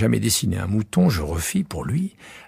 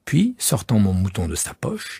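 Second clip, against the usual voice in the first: plain reading voice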